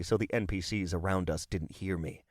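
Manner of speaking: plain reading voice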